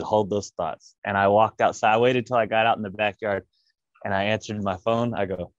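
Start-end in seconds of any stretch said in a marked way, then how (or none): none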